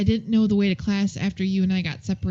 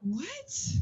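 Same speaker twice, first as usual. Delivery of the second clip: surprised what; 12 semitones higher, 10 dB louder than usual. Real, not acted. Reacts to odd question.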